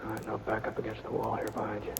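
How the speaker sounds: tense near-whisper in a dark cave; hushed. Acted. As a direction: keep it hushed so the outlaw can't hear. Sneaking up on hidden outlaw.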